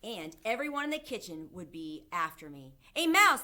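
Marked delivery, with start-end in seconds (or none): 2.9-3.4 high, loud shout of alarm. A narrator's reading. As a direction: raise the alarm at full voice. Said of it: "they were shouting".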